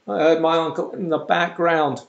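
ordinary talk

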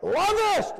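high, loud, ringing exclamation; passionate cry. Pretend. Preacher shows the congregation how to say it with passion, high loud cry. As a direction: belt "lovest!" high and loud with full passion.

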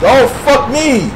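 high, loud, slow curse; exasperated swear; frustrated outburst. Real, not acted. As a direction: cry out caught off guard. Sudden enemy ambush.

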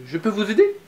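put-on eager child. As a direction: ask to join in, hopeful. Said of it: "demande Anton".

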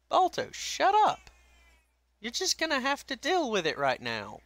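high-pitched telling-off, easing down at the end; high, exasperated pet-owner voice; scolding a pet. Real, not acted.

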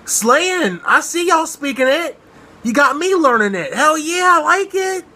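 high, sassy fan praise; excited hyping. Real, not acted. Hyping the singers' Spanish.

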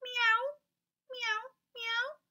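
very high meowing cat. Shown as a demonstration. Teacher meowing like a cat for the kids. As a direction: mew like a kitten. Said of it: "say meow like a cat".

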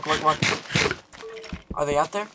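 ordinary talk

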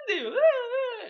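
high, loud, slow wail; loud yelling. Retold or imitated. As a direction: yell so it carries down the stairs. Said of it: "階段まで聞こえる声で". (階段まで届く高く大きなゆっくりした叫び)

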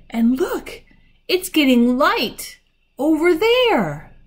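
high, drawn-out wonder; excited discovery. A narrator's reading. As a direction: call attention to an amazing sight. Susan points out the light.

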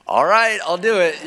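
loud, high, eager agreement; eager yes. Retold or imitated. Telling the worship team, loud and high eager yes. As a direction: jump at the chance to play.

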